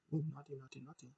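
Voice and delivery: very hushed playful tutting; teasing mock reproach. Host chiding Bray for the S word, hushed teasing. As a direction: murmur it as a playful telling-off.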